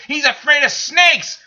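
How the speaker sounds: real slow furious shout; angry shout. Real, not acted. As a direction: yell it like a furious fan correcting the record. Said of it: "I am so pissed".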